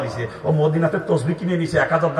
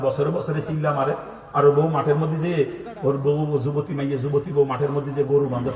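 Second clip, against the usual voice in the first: ordinary talk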